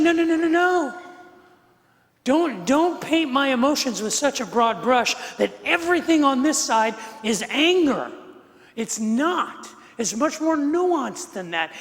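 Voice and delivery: loud, high imitation of objecting; emphatic protest. Wife objecting to husband's reading of her emotions, high loud protest.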